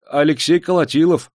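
prompt, high-pitched answer; hasty reply. A narrator's reading. Burly bearded man answers the clerk's question, hastily. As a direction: answer up at once. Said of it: "спешно отозвался дородный бородач".

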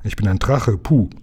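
mildly screechy dragon voice; half-hearted creature screech. A narrator's reading. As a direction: lightly squawk like a little dragon. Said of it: "kreischte der Halbdrache".

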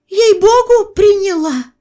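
high, loud squeal of an oath; loud, high-pitched protesting; insistent peasant woman. A narrator's reading. Peasant woman swears she took it.

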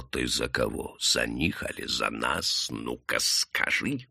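low, quiet menace; грозный дьякон. A narrator's reading. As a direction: interrogate him slowly, low and soft. Deacon grips him by the throat. (тихая низкая угроза)

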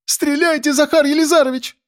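put-on very high, frantic girl's voice; high-pitched excited plea; urgent young woman. A narrator's reading. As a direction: urge him on in a high, thin voice. Girl urges editor to shoot.

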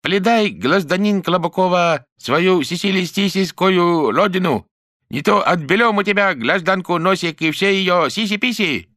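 put-on high, slow Chinese accent in Russian; lisping Chinese accent, hammed up. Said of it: "просючукала она с китайским акцентом".

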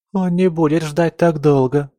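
a touch of a sob in the protest; sobbing protest. A narrator's reading. Camilla tells mother he will not wait, mildly sobbing. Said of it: "зарыдала Камилла".

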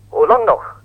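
loud, high outcry in a newsreader's voice; exclaimed. Retold or imitated. Correspondent quoting the Russian leader, loud exclamation. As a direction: cry it out as a rhetorical demand. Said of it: "riep de Russische regeringsleider uit".

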